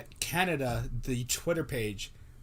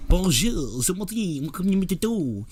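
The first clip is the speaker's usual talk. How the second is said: higher, Bonjour je m'appelle in a hammed-up French voice; mock French; 6 semitones higher, 4 dB louder than usual. Mocking bilingual bar signs in the Territories, hammed-up French. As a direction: play a bar guy greeting in French. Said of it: "That sounded weird".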